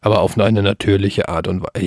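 low robotic monotone; forced deep robot voice. Shown as a demonstration. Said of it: "Jetzt rede ich wie ein Roboter".

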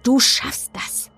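forceful, intense pep talk; snapped encouragement. A narrator's reading. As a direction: drive each word home urgently. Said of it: "fuhr Kit mich an".

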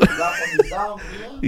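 loud, excited bragging; loud proud boast. Real, not acted. Boasting to friends that the show was theirs, loud. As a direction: brag about it loudly.

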